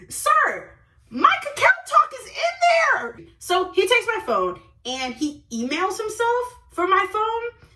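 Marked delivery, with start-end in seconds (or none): 0.1-3.2 high, shouted protest; hammed-up shrieking indignation; outraged protest. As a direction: yell it in alarmed disbelief. Acted outrage at hacked phone.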